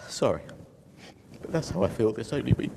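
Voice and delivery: choking up, fighting real tears mid-sentence; crying. Real, not acted. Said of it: "And I don't know why I'm crying".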